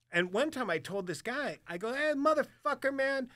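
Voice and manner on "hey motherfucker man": high-pitched worked-up rant; high, agitated mock complaint; retold angry outburst. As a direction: act out being fed up and riled.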